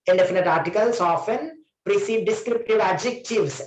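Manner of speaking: usual speaking voice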